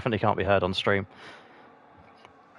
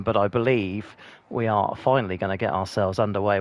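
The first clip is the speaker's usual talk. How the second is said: usual speaking voice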